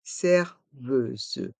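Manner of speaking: "Serveuse" in slow syllables, final S voiced as Z; S pronounced Z. Shown as a demonstration. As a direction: split "Serveuse" slowly, buzzing the last S. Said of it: "Le S se prononce Z".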